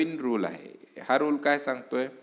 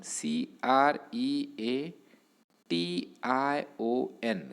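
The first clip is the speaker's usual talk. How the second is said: slow letter-by-letter spelling of क्रिएटवरून सीआरईएटीआयओएन; 4 dB quieter, much slower than usual. Shown as a demonstration. Teacher spelling the new word for students, slow letters. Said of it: "spells the noun letter by letter".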